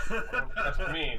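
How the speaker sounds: laughing through the words; slow, amused self-mockery. Real, not acted. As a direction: laugh at yourself as you say it. Player laughing at own weakness.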